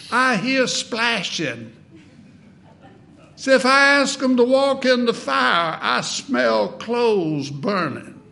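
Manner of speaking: high nasal preacher voice; sustained high-pitched nasal impersonation; R G Lee. Retold or imitated. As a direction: imitate him high and nasal, drawing out the punchlines. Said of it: "in that high nasal voice".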